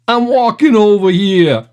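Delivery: a cartoon New York hot dog vendor, lightly done; New York accent. Quoting a cartoon hot dog vendor, in a hint of a New York accent. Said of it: "I got it from a cartoon".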